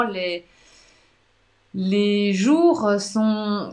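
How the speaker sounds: ordinary delivery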